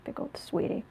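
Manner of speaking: soft, hushed cat voice; voicing the cat. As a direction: speak for the cat, pleading softly. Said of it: "Boo Boo Zen Kitty is like".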